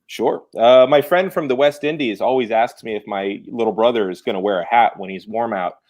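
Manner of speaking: ordinary talk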